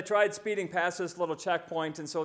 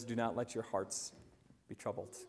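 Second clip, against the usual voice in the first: "Do not let your hearts be troubled." much softer, fading on "troubled"; soft and reassuring; 5 semitones lower, 8 dB quieter than usual. Acted. Preacher repeats Jesus' words after the bullet story, softly. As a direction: let the words sink in gently.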